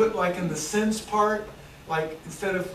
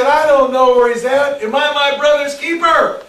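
higher, louder snapping reply as Cain; defiant retort; 6 semitones higher, 10 dB louder than usual. Acted. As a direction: Cain brushing God off loudly. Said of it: "And he said".

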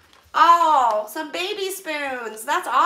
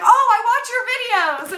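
high, loud starry-eyed gush; a fan gushing in the baking aisle; 8 semitones higher, 7 dB louder, much faster than usual. Retold or imitated. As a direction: tell her you watch her, thrilled. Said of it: "she's like".

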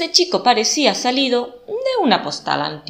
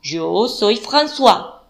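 put-on French accent; 4 semitones higher, 6 dB louder than usual. A narrator's reading. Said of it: "su marcado acento francés".

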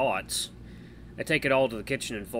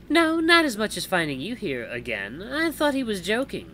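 higher-pitched character voice, amused; female visitor, teasing; 9 semitones higher, 4 dB louder than usual. Acted. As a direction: speak up high as a teasing woman.

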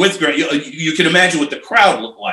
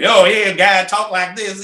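put-on Missouri accent; 6 semitones higher than usual. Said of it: "he's from Missouri".

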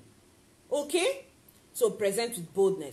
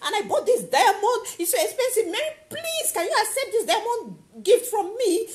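high, pleading, louder begging voice; begging friend; 9 semitones higher, 5 dB louder than usual. Retold or imitated. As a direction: plead with Mary like a desperate beggar. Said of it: "I go call Mary".

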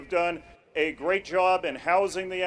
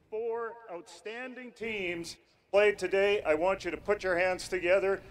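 normal talking voice

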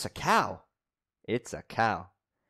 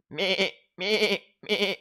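higher bleating goat sound; 8 semitones higher than usual. Acted. Teacher imitates animal sounds.

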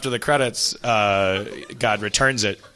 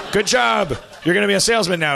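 higher, cheering praise; hearty congratulations; 7 semitones higher than usual. Acted. Salesman school congratulates its graduate, higher and hearty. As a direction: congratulate the new graduate with pep.

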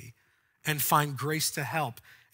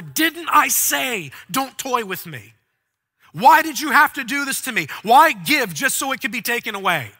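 anguished accusation; 7 semitones higher, 9 dB louder than usual. Acted. Grieving mother accusing the prophet, loud anguished cry. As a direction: hurl the grief back at him.

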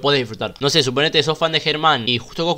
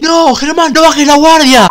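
much higher, louder warning call to Germán; imagined desperate cry to the fighter, much higher and louder; pleading shout; 11 semitones higher, 12 dB louder, slower than usual. Pretend. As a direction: yell the warning as if you could stop him. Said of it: "volver en el tiempo con esa máquina y decir".

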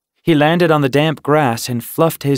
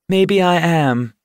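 playful; 6 semitones higher, 3 dB louder than usual. A narrator's reading. She teases, purring with amusement.

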